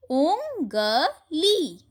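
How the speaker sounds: उंगली stretched very slowly, pitch up; slow drawn reading. Shown as a demonstration. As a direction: draw out उंगली for the class. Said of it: "चंद्र बिंदु से बने कुछ शब्दों". (उंगली को बहुत धीरे खींचकर पढ़िए)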